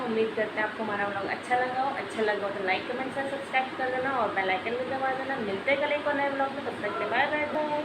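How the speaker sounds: soft, quiet sign-off to viewers; vlog outro. Acted. Vlogger signing off to her viewers, quiet outro. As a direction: wrap up the vlog gently.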